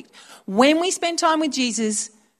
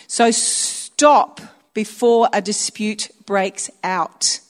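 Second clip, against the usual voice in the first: slow scripture reading; 3 dB louder, much slower than usual. Shown as a demonstration. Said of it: "reading scripture slowly".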